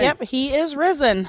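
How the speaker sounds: loud call. Real, not acted. Easter greeting, calling out.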